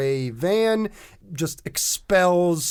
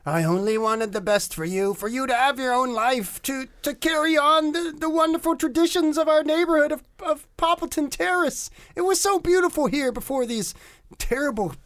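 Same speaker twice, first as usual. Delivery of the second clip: much higher, rising, teary motherly wail; blubbering; 11 semitones higher, faster than usual. Acted. Alien mother saying goodbye to her robot son, high blubbering. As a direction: sob out a farewell to your creation. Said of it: "blubbers, saying".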